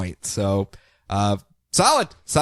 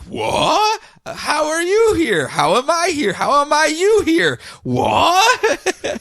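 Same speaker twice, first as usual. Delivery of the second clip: high-pitched, drawn-out mock bewilderment; baffled Raos; 12 semitones higher, much slower than usual. Two selves meeting in confusion, hammed-up bafflement. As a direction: overplay the confusion in a high, stretched voice. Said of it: "both of the Raos are just like".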